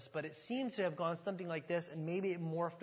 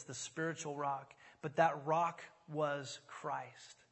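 ordinary talk

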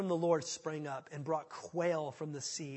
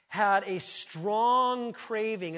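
slow drawn-out craving; 3 semitones higher, 8 dB louder, much slower than usual. Acted. Reading Israelites' complaint aloud.

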